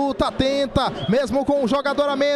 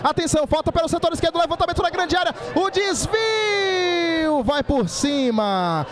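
play-by-play; 6 semitones higher than usual. Real, not acted. Calling a free kick live.

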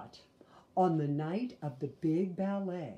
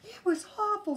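put-on upset child; 5 dB louder than usual. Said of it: "Sister said".

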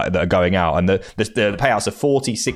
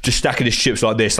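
normal talking voice

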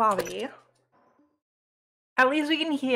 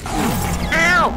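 yelp of pain; 5 semitones higher, 7 dB louder than usual. Real, not acted. Hit in game, yelps.

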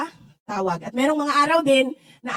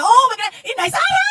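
much higher, louder, laughing shout of recognition; surprised delighted shout; 9 semitones higher, 3 dB louder than usual. Retold or imitated. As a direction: mimic a fan suddenly spotting her, squealing "Inday Sara!". Woman recognises her, laughing shout.

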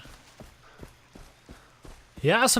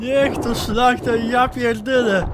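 yelling in panic, high and loud; panicked shouting; 8 semitones higher, 6 dB louder, slower than usual. Real, not acted. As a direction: shout the swears in fright. Alligator attack, swearing.

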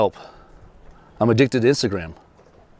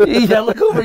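high, louder, through laughter; laughing while talking; 12 semitones higher, 5 dB louder than usual. Real, not acted. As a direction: talk through the giggles.

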